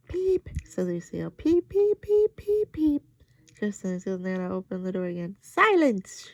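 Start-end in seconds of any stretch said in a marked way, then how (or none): 0.1-3.0 very high, loud chick peeps; silly peeping. A narrator's reading. Overtired Lucille keeps peeping, very high and loud. 5.4-6.3 very loud, high shout of silence; scary yell. A narrator's reading. Nana bursts in and yells at the girls. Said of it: "she yelled, real scary".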